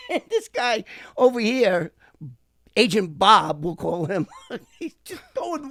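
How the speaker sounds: words bubbling up through real laughter; laughing while telling. Real, not acted. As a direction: crack up while telling the story. Storyteller cracks up at his story.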